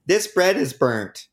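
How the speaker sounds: high, slow, whiny mock complaint. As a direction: imitate a picky customer. Mocking customers' complaint.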